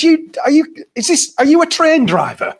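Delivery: high, excited disbelief; a schoolmate amazed at his friend the train driver; retold astonishment. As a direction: ask it high, amazed he made it. Retelling his own astonished question.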